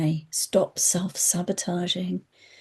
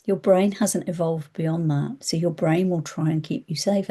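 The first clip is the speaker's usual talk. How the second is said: normal talking voice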